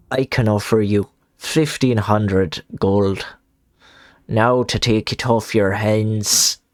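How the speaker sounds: slow, lightly put-on Irish accent drifting to Somerset; Irish accent slipping into Somerset. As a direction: read the trader line slowly in a wobbly Irish accent. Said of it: "When you do Irish, you do Somerset".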